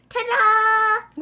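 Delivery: high, loud showman cheer; triumphant ta-da. Acted. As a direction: announce it like a magic trick. Frog surfaces with ball.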